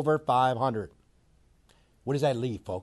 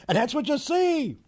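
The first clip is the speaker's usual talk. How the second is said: high, laughing through the words; 11 semitones higher than usual. Real, not acted. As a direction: chuckle as you say it. Laughing after mocking A-Rod.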